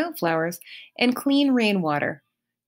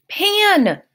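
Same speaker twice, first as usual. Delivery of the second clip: sharp, high, loud outburst; indignant cry; 13 semitones higher, 6 dB louder than usual. Acted. Grover correcting Percy, indignant and loud. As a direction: snap the name back, offended. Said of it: "he cried indignantly".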